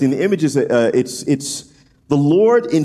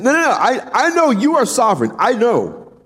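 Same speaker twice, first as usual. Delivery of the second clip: high pleading voice, pitched up; pleading Amos; 9 semitones higher than usual. Retold or imitated. Preacher voicing Amos pleading with God, pitched high. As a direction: beg God, voice raised high. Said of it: "He's like".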